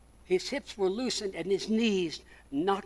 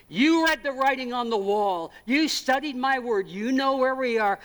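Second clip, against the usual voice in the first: voice of God; 4 semitones higher, 6 dB louder than usual. Acted. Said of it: "God is going to say to you".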